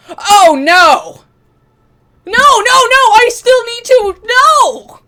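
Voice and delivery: high, loud cries of no; anguished shouting. Real, not acted. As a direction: yell in real dismay as a friend dies. Favourite character dies on screen.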